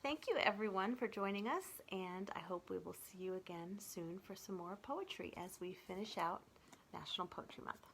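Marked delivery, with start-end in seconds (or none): 1.9-7.8 whispered goodbye to viewers. Real, not acted. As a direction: soften into a whisper. Soft sign-off to viewers.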